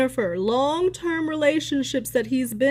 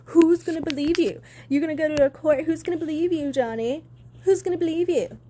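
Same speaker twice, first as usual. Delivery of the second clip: higher, sneering imitation of a taunt; mock ex-wife taunting her husband, higher and sneering; mocking Amber Heard; 5 semitones higher, faster than usual. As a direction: throw it at him like a dare. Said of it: "You sat up there and said to this man".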